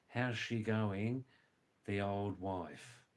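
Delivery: slow, spaced-out explanation of slang; slow demonstration for a learner; slow gloss. Shown as a demonstration. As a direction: say it slowly, pausing before the old wife. Slower gloss of slang phrase.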